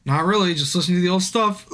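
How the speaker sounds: normal talking voice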